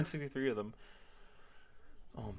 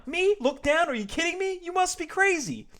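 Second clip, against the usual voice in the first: very high, loud whiny denial; outraged George; 6 dB louder than usual. Acted. George denying to the cashier, shrieking outrage. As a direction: squeal it like the accusation is absurd.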